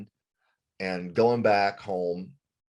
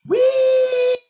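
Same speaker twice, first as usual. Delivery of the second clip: squealed much higher and louder, gleeful; a bandmate whooping down the store slide, much higher; mocking bandmate squeal; 10 dB louder than usual. Singer imitating guitarist on the slide, high gleeful squeal. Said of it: "he went".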